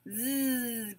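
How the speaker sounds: "z" as a long buzz; soft, sustained "z" with no vowel; isolated end sound. Shown as a demonstration. Teacher showing what both words end with, held consonant. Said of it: "Both end with".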